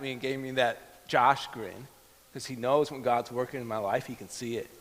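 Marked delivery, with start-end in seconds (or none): none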